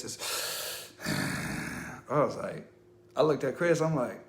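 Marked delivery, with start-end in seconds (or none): 0.9-2.0 a very low growling exhale; imitated deep growl breath. The unknown caller growls down the line, deep breath. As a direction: rumble out a breath like a demon. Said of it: "It was a deep voice".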